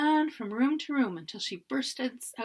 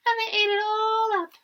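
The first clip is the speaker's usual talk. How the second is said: put-on high squealy Baby Bear; 11 semitones higher, 9 dB louder than usual. A narrator's reading. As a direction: squeal like a tiny cub. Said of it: "in her high, squealy voice".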